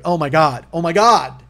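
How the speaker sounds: loud, high exasperated groan of disbelief; exasperated outburst. Real, not acted. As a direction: let it burst out, fed up. Exasperated outburst at her reply.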